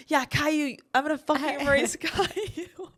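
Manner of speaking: high-pitched mock challenge breaking into laughter; hammed-up battle cry cracking into giggles; mock fired-up boast. Imitating fired-up drunk friend, laughing.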